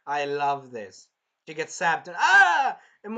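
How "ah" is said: high, loud mock scream; short hammed-up yelp of pain; zapped scream. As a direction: cry out as if zapped. Acts princess being zapped.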